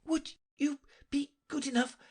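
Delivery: high, halting and out of breath; panting. A narrator's reading. Alice asks the King while running, panting. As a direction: pant it out word by word. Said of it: "Alice panted out".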